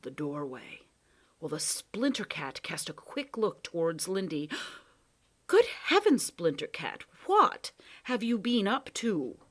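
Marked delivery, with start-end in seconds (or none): 5.5-9.4 high, loud mock astonishment; mock-surprised Proc. Proc feigns surprise at the cat's work, mock shock. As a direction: pretend to be shocked.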